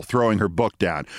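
ordinary delivery, nothing marked

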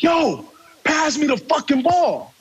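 a teammate's angry demand, pitched high; mock-angry shout to pass the ball; retold angry demand. Teammate slaps bench, demanding ball.

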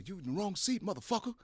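high yelled threat from behind; retold yell. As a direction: bark it like an angry teammate. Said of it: "I'm hearing somebody go".

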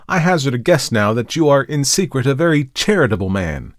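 intense accusation. Acted. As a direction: grill him with mounting menace.

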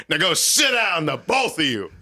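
retold telling-off with force; mock angry order. Father ordering both sons to sit, mock stern.

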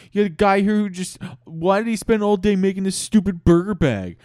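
high, loud incredulous tirade; outraged rant. Acted. Chad insulting everyone in the room, loud outraged rant. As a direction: rail at everyone in whiny disbelief. Chad rants at the whole club.

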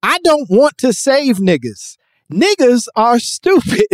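high, loud mock declaration; imitated speaker's rant. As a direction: mimic a speaker declaring it to a crowd. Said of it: "where he's like".

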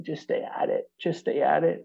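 soft, half-whispered retold reassurance; hushed imitation of a supportive partner; gentle encouragement. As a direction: urge it quietly, almost under the breath. Girlfriend's encouragement from afar, retold.

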